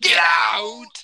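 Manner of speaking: loud, breathy shouted call-out; shouting. Acted. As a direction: hurl it out. Get out catchphrase shout.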